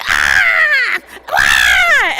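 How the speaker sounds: put-on piercing high baby scream; imitated baby shriek. Storyteller mimicking the plane baby, sudden loud shrieks. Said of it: "all of a sudden we would be like".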